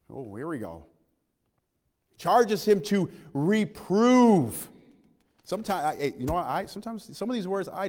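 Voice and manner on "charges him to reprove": very slow, drawn out; slower emphasis. Acted. Preacher repeating the charge to the church, very slow. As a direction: stretch every word to drive it home.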